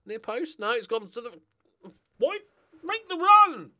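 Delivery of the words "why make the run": frustrated shout. Real, not acted. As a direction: yell it high and loud at the screen. Shouting at player in game.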